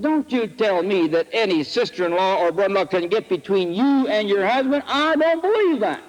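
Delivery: retold rebuke climbing steeply in pitch; indignant challenge. Preacher challenges woman's in-law story, rising indignation. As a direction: build to a high, emphatic refusal.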